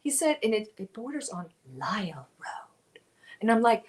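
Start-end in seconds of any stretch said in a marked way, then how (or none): 0.4-3.0 hushed, whispery mock narration; soft narrator impression with a breathy finish; imitating audiobook narrator. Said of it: "that's how he said it".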